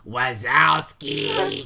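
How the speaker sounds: very slow, drawn-out monotone croak; Roz from Monsters Inc. Retold or imitated. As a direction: drag the name out low and slow. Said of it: "the woman from Monsters, Inc. says".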